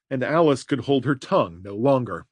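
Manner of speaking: plain reading voice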